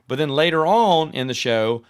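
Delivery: ordinary talk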